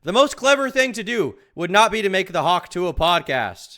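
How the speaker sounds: loud, high-pitched rebuttal; exasperated shouting. Real, not acted. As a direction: yell the argument in disbelief.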